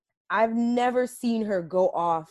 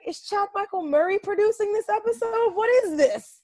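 high-pitched exasperated disbelief; baffled, much higher than usual; incredulous; 11 semitones higher than usual. Retold or imitated. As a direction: question it in mock outrage. Mock disbelief at sappy episode.

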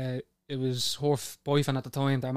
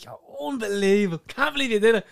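excited mate; 7 semitones higher, 5 dB louder than usual. Retold or imitated. Mate raving at him in bar.

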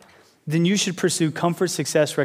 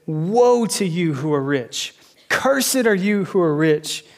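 slow, grave woes with "Woe" and "Cursed" leaned on; solemn scripture reading; slower than usual. Acted. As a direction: read the curses like a prophet.

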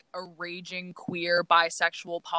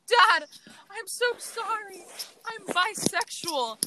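high, drawn-out tearful whine; mock tearful confession; 13 semitones higher, slower than usual. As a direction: confess it in a hammed-up, squeaky sob. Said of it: "burst into tears".

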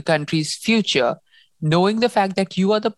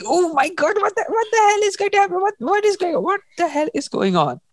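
much higher, panicked disbelief; shocked disbelief; faster than usual. Retold or imitated. Reader retelling her own shock at the scene, high panicked. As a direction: squeal your horror at the knife scene. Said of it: "And I'm like".

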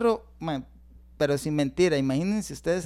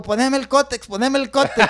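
retold yelling; 5 semitones higher, 4 dB louder than usual. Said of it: "gritaba en el patio".

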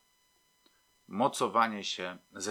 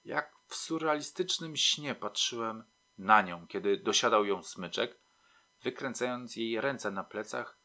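plain reading voice

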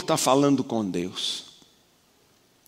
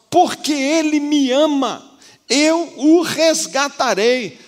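much higher, louder, slow proclamation of God's promise; voice of God preached; 12 semitones higher, 7 dB louder, slower than usual. Acted. Preacher voicing the Lord to the church, proclaimed high and loud. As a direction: proclaim it as God rescuing, slowly. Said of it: "O Senhor tá dizendo pra nós".

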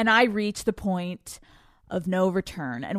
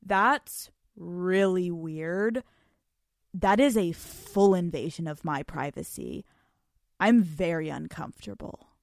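put-on flat cold boyfriend; 6 semitones lower, 5 dB quieter than usual. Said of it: "he goes".

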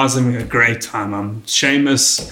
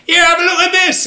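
heckling audience member. Retold or imitated. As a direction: imitate a punter calling out, pitched way up. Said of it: "I'm gonna fuck up his accent".

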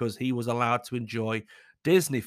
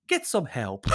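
ordinary talk

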